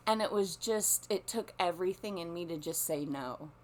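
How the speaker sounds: normal talking voice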